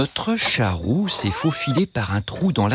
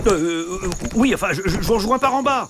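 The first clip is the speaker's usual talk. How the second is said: high, fast flustered stammer; nervous stammer; 7 semitones higher, faster than usual. Acted. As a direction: stammer out an excuse.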